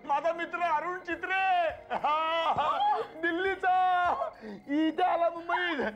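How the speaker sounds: high-pitched, exuberant phone greeting; overjoyed. Acted. Husband hears old friend Arun has come to Mumbai, overjoyed. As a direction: burst with delight, cheering the news.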